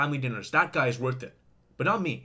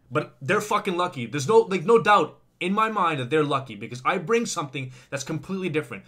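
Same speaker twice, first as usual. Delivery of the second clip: louder, insistent boasting; louder and fired up; louder emphatic boast; 3 semitones higher, 7 dB louder than usual. Real, not acted. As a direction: press it hard, almost shouting.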